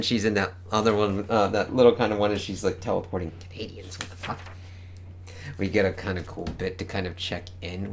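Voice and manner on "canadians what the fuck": much softer whispered aside; amused whispered disbelief. Real, not acted. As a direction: breathe it under your breath. Aside about Canadians.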